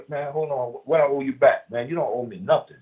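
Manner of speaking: a hint of a caring mentor; sincere pay it forward. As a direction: wave off the debt kindly, from the heart. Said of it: "You look at me and you say".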